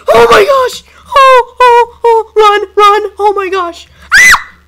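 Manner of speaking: frantic high-pitched panic yells; panicked screaming. Real, not acted. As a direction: scream and run for your life. Panics as monster attacks.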